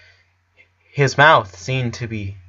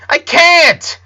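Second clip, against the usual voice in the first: much higher, louder, screaming; 8 dB louder than usual. A narrator's reading. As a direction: shout it out in despair. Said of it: "Lincoln screamed".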